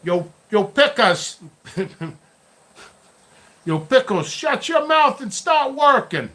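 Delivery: mock scolding. Telling Pickles to start working, mock scolding. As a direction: scold Pickles in a high, drawn-out playful holler.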